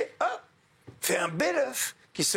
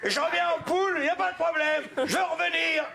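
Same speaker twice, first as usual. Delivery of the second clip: impatient eagerness; 11 semitones higher, faster than usual. Retold or imitated. Eager man accepts becoming hen.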